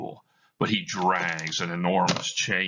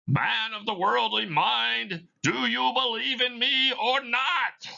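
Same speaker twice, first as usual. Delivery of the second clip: ghostly Marley voice. Acted. Dead partner answering Scrooge, eerie high ghost voice. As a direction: moan it out like a spirit.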